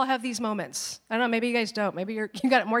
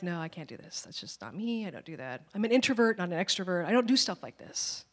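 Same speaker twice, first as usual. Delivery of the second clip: reluctant protest; 4 semitones lower, 5 dB quieter than usual. Retold or imitated. Believer backing away from God's call, soft reluctant refusal. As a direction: lower and much softer, shrinking back. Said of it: "And we're like".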